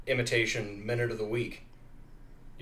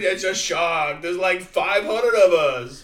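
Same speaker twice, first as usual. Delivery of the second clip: mock mobster; 5 semitones higher, 6 dB louder, much slower than usual. Mobster bit.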